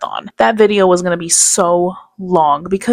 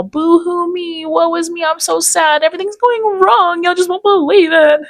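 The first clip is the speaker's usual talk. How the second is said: mocking whiny self-pity; 9 semitones higher than usual. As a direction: whine it like a sulking crybaby. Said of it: "it was all".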